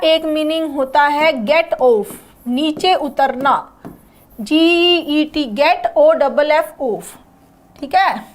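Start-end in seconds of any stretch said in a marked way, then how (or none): 4.4-6.7 जीईटी and ओ डबल एफ spelled out slowly; spelled letter by letter. Shown as a demonstration. Teacher spells get off slowly for the class.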